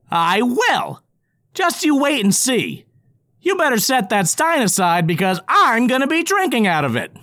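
high loud boastful goblin; cocky goblin. Acted. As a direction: brag to the dwarf. Goblin character voice.

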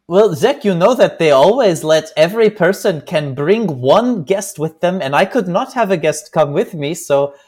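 imitated Swiss accent, lilting; careful Swiss-accented English, impersonated; Beat Just. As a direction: make a kindly offer in a Swiss accent. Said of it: "my impersonation of him".

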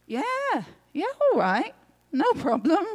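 high, swooping, lighter yeah; breezy agreement. Acted. Luke eagerly agrees with the man.

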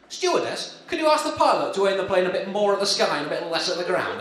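mock-innocent plea to the stewardess, hinting at [name]; [name] impression. [name] asks the stewardess about the plane, lightly voiced. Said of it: "this is my [name] impression".

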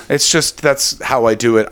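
ordinary talk

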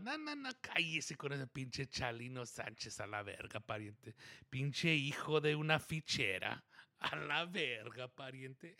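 mumbled Spanish trash talk that fades to a near whisper; imitating a friend, muttering and trailing off; El Pariente quote. El Pariente ripping on a singer, muttered and fading. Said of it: "He's like".

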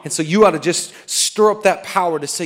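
normal talking voice

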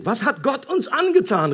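high-pitched cry of alarm; storyteller's mildly anguished exclamation; frightened cry. A narrator's reading. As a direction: cry out high, a touch of fear. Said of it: "mit der Angst zu tun".

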